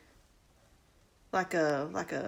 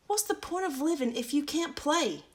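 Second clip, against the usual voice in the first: put-on child Clara; 6 semitones higher than usual.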